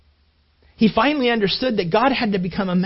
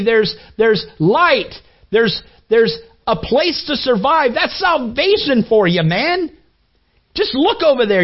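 loud, higher yelling at a bird; screaming at bird; 5 semitones higher, 4 dB louder than usual. Retold or imitated. Father yelling at the bird through the window, loud and pleading. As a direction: yell it across the yard, urging. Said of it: "scream at the bird and say".

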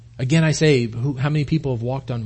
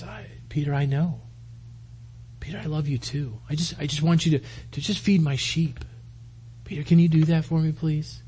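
soft, gentle reassurance; tender forgiveness; 7 dB quieter than usual. Acted. Jesus forgiving Peter and entrusting his sheep, hushed and tender. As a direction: speak quietly and kindly, with unhurried pauses.

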